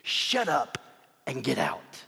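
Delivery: blunt order, no courtesy; impolite command. Acted. Jesus dismissing the demon, blunt command. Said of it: "It's not very polite".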